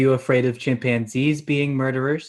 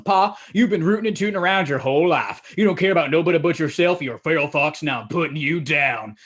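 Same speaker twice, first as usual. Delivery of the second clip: folksy son telling off his pa; hammed-up country showdown speech, slowing at the end; confronting grandpappy; 3 semitones higher, 4 dB louder than usual. Grandpappy confronts his pa at the house, hammed-up defiance. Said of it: "He tells him".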